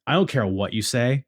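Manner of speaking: ordinary delivery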